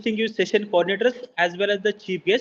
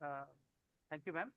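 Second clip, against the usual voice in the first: very faint, far from the mic; barely audible; 6 semitones lower, 23 dB quieter than usual. Real, not acted. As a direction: voice too low to hear. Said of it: "but your voice is very low".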